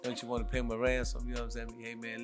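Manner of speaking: normal talking voice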